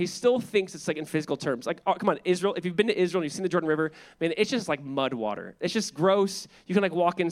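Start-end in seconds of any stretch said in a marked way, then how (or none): none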